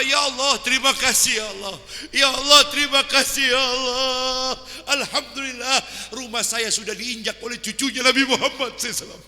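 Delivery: fervent prayer. Acted. Sheikh thanking God loudly for the honoured guest.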